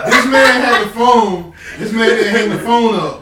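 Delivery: can't believe it, laughing. Real, not acted. Telling the hosts about the driver, laughing.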